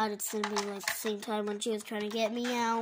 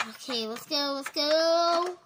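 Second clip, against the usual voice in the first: usual speaking voice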